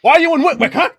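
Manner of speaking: lightly voiced innkeeper; suspicious innkeeper. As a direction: press the strangers.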